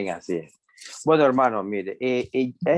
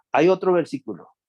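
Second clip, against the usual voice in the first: ordinary talk, nothing marked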